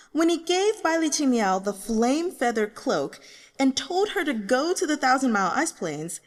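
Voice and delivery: high, loud, fast-talking fan rant; excited rant. Acted. Fan ranting about revealed characters on a discussion page, excited. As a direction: rant like an excited forum reader. Forum reader rant continues.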